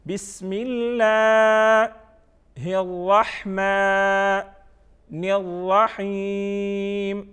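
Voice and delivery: very slow chant with pauses between parts; recitation by parts. Shown as a demonstration. Teacher models Bismillah for pupils part by part, slow chant. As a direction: chant piece by piece with long held vowels.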